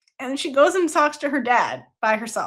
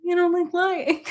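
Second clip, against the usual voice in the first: higher, disbelieving and laughing; amused, mock-outraged echo; incredulous [name]; 7 semitones higher than usual. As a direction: throw the words back at her in disbelief.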